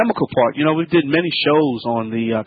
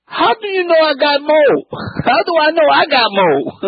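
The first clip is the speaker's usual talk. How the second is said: much higher mock caller voice, laughing through it; voicing the caller; 12 semitones higher than usual. Host reading the caller's question to the guest in a high, laughing caller voice. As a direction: play the puzzled caller asking, high-pitched and amused. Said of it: "Miss Annabelle Fremo wants to know".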